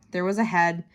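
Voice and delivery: usual speaking voice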